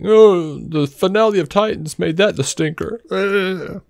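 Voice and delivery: high-pitched griping imitation; mocking fan complaint; mock complaining fan. Mimics complaining fans.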